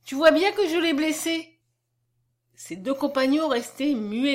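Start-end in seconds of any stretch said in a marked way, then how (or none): 0.0-1.6 put-on higher-pitched, mildly distressed child; upset little girl's outcry; distressed broken cry. A narrator's reading. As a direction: cry it out high, with understated anguish. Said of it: "cria-t-elle d'une voix entrecoupée".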